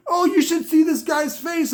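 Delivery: a hint of the high hype-host voice; mock hype voice. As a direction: keep the over-excited mockery going lightly. Mocking the hype video.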